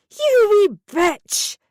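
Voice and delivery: slow, high, loud screech. A narrator's reading. Mum chasing her daughter downstairs, slow furious screech. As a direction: screech it down the stairs. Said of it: "she screeched".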